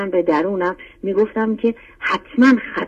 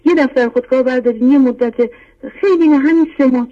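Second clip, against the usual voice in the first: higher and louder, pressing the listener; urgent urging; 6 semitones higher, 4 dB louder than usual. Real, not acted. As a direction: push «یه دفتر خودکار بردارید» with rising insistence. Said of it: "repeats the advice more forcefully". (با اصرار و هیجان، بلندتر)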